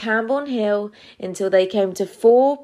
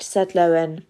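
ordinary delivery, nothing marked

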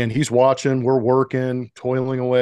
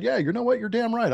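cocky; 10 semitones higher than usual. Retold or imitated. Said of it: "too cocky maybe at the time".

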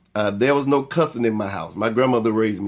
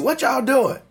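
much higher-pitched questioning call; imitating uncle's challenge; 11 semitones higher than usual. Uncle catching the kids cussing upstairs, mock challenge.

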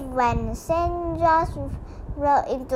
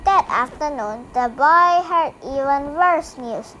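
ordinary delivery, nothing marked

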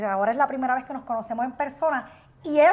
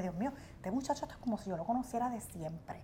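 soft marvel; 3 semitones lower, 14 dB quieter than usual. Retold or imitated. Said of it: "yo dije".

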